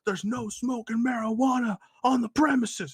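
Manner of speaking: high, slow, official scolding; retold security guard. Security guy confronting a smoker, high mock-official voice. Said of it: "the security guy walks out".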